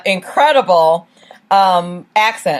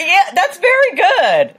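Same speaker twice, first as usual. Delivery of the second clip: laughing; 11 semitones higher, slower than usual. Real, not acted. Laughing about his Twitter handle.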